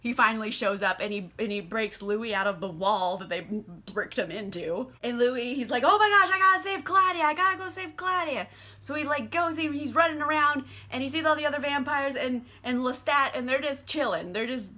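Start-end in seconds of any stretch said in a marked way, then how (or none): 5.8-8.5 high, loud, frantic rush of words; mock panic. Louis freed, desperate to save Claudia, mock panic. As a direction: blurt it out in exaggerated alarm. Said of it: "he's really scared about Claudia".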